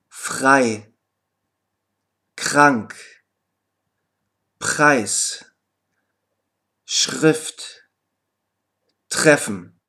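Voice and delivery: R like a throaty ch in "Frei", "krank", "Preis"; devoiced R. Shown as a demonstration. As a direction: model each word separately with a voiceless R after the consonant. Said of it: "überträgt sich die Stimmlosigkeit auf das R".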